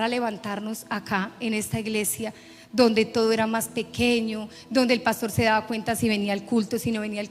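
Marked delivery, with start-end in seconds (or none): none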